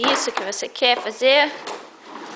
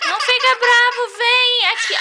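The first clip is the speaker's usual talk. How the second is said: high coaxing; 7 semitones higher than usual. Real, not acted. Coaxing upset toddler son.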